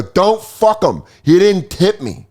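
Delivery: put-on high, slow drunken warning; drunkenly animated. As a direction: call out a drawn-out, animated protest. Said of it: "I'm starting to get pretty animated".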